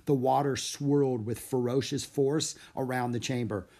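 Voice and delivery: ordinary delivery, nothing marked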